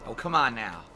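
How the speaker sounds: ordinary talk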